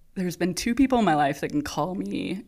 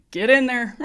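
mock order in a Southern accent, higher and louder; 7 semitones higher, 7 dB louder than usual. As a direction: imitate a Southern charge nurse's command. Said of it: "great Southern accent".

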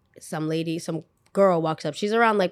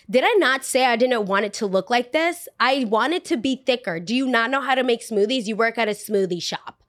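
higher-pitched, indignant questioning; snotty customer; 4 semitones higher than usual. Retold or imitated. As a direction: retell it as a demanding customer. Rude customer berates worker, acted.

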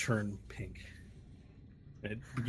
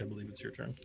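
ordinary delivery

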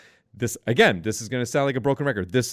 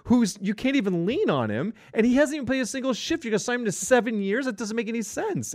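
exasperated rant; 8 semitones higher than usual. Real, not acted. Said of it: "that pissed me off".

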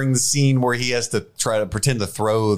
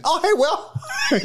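mock greeting; slower than usual. [name] greeting Will unseen.

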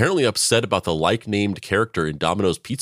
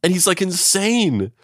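much higher, hushed alarm; mock alarmed hostage; 10 semitones higher than usual. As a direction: play a hostage suddenly getting it. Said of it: "you'd be like".

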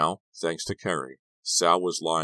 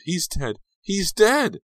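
high-pitched wail, louder on the repeat; horrified scream; 7 dB louder, slower than usual. A narrator's reading. Girlfriend believing Sal is dead, horrified scream. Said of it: "she screamed".

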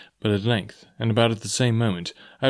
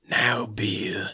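softer, cold menacing whisper; 4 dB quieter than usual. A narrator's reading. Blind beggar cornering the captain in a whisper. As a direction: whisper the name like a threat.

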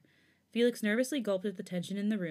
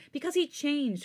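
arguing; 8 semitones higher, 4 dB louder, slower than usual. A narrator's reading. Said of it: "Felix argued".

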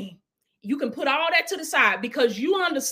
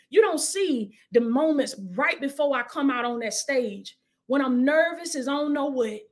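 ordinary talk, nothing marked

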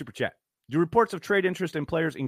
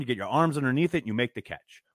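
ordinary delivery

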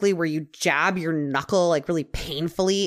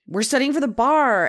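mock desperate; 5 semitones higher, 4 dB louder than usual. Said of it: "he's just sounding more and more desperate".

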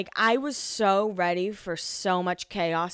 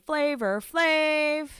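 drawn-out catchphrase; 7 semitones higher, much slower than usual. Retold or imitated. A fan walking around saying it, drawn out high and slow. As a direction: stretch the name like the show's catchphrase. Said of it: "we all walked around saying".

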